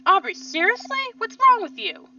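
high, loud, indignant questioning; loud outburst. Acted. Kel confronting Aubrey inside the church, loud outburst. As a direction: raise your voice sharply in disbelief. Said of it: "now he's being loud".

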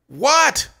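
high, loud shouted question; scream from afar. A narrator's reading. Dina yelling back from another room, loud. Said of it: "Dina screamed from another room".

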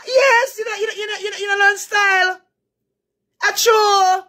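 high, loud, wailing cries; high excited shouting. Pretend. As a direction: wail it out high.

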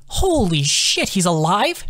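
slow, high startled gasp of words; stunned, drawing each word out high; shocked disbelief. A narrator's reading. Corpse suddenly twitches.

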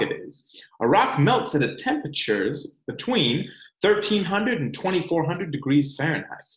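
expressive storybook read-aloud; reading to children. Acted. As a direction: read it like story time for little ones. Reads picture book to children.

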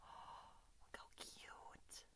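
very slow hushed whisper; gasp, then whisper it. Real, not acted. Looking at the tiny doll, slow whisper.

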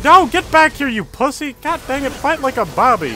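high, agitated taunting; worked-up scolding. Real, not acted. Gamer taunting a fleeing enemy, high and worked up. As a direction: start loud, then scold in a high voice.

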